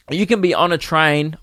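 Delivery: ordinary talk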